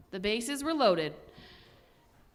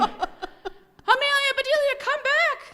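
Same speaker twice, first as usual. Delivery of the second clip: a high, louder shout calling her back; 6 dB louder than usual. Acted. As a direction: call after her at full voice. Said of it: "shouted the boys".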